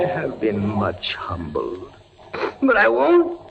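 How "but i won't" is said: high, strained voice holding back tears; anguished. Acted. Prisoner ordering Beatrice to leave, fighting tears. Sending his love away in anguish.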